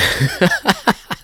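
high, loud burst of laughter; real laugh, high and loud; amused laugh. Real, not acted. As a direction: laugh out loud at an absurd claim. Amused by the clip just played.

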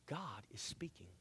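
hushed whisper of God is speaking. Acted. Preacher whispering to the church for effect. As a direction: lean in and whisper it.

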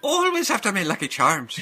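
Irish accent. Shown as a demonstration. Showing friends Irish as in the cereal commercial, Irish accent. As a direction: do a commercial leprechaun's Irish lilt. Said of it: "Irish is".

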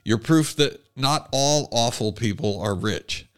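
usual speaking voice

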